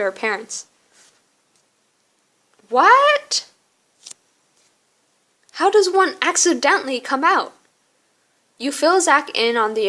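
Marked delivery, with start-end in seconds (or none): 2.7-7.5 high, rising shocked "What?"; incredulous. Acted. Friend hearing about the accidental coming out, incredulous. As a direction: blurt it out in disbelief, pitched up.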